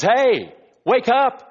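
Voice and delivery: loud, urgent "Hey, wake up"; wake-up call. Retold or imitated. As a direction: shake the listeners awake. Paul rousing the hearers.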